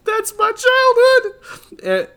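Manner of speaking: high, slow, tearful voice; choked-up retelling. Retold or imitated. Retelling to his friend how he teared up, tearful and slow. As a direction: let the words crack as if tearing up. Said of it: "all I said was".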